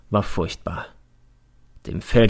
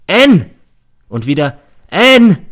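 loud, much higher call across the woods; calling the missing boy in the woods; 8 semitones higher, 9 dB louder, slower than usual. Retold or imitated. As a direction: call the name far into the forest. Search party calling a name.

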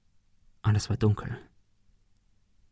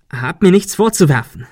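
higher, louder insistence; defensive protest; 6 semitones higher, 5 dB louder than usual. A narrator's reading. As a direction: insist on innocence. Ben protests. (trotziger Protest)

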